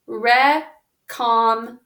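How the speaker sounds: recommend blended slowly; slow whole word. Shown as a demonstration. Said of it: "that's our whole word".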